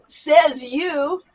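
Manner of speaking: cocky comeback; skeptical retort. Shown as a demonstration. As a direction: doubt them out loud. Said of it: "the other person might say".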